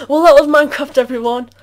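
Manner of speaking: ordinary talk, nothing marked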